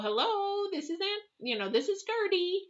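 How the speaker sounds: put-on high, thin old-lady hello; aged aunt on phone. Ninety-two-year-old aunt answering niece's call, high old voice. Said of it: "she's like".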